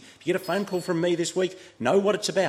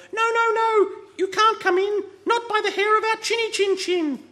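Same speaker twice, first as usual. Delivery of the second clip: very high, loud squeaky pig voice; tiny pig shrieking through the door; squeaky little pig; 5 dB louder than usual. A narrator's reading. Two pigs call out.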